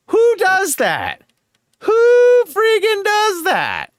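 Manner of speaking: high, slow, drawn-out outraged disbelief; exasperated disbelief. Real, not acted. Streamer ranting at troll who stalked his friend, exasperated. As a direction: stretch it out like you can't believe it.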